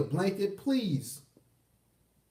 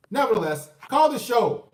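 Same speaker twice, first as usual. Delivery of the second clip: pitched up and louder, like a radio host hyping callers; loud announcer call; 6 semitones higher, 6 dB louder than usual. Real, not acted. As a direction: belt it out to the audience. Host suddenly loudly urges callers.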